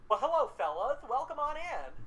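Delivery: sing-song welcome, pitch swooping up high; shopkeeper voice. Acted. Goose shopkeeper welcoming customers in, sing-song and high. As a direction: greet them like a too-friendly shop owner.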